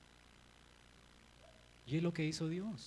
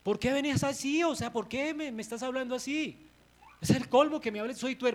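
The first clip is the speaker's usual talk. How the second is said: higher, louder offended whine; voicing Aaron's indignant protest; 7 semitones higher, 6 dB louder than usual. Aaron protesting to Moses, indignant and offended. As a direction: protest like a wronged little brother. Preacher voices Aaron defensive.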